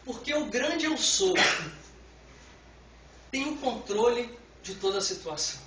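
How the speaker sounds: usual speaking voice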